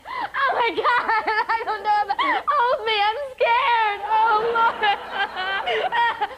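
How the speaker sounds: high, tearful mock terror; panicked whimpering. Patient ordered to eat brownies, mock-terrified wailing.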